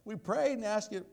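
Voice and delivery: high, loud mock wail of complaint; hammed-up lament to God; mock complaining.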